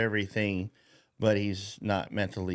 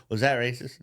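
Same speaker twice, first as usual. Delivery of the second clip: ordinary talk, nothing marked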